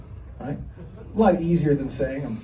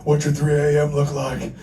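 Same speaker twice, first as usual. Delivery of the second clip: raspy Stone Cold impression; 3 dB louder than usual. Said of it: "This one guy had a real raspy voice".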